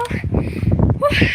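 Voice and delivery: high unvoiced puff of air; breathless whew. Real, not acted. Winded after climbing to the roof, breathless whew. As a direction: blow out, winded. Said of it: "I'm out of breath".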